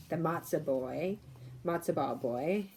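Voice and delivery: ordinary delivery, nothing marked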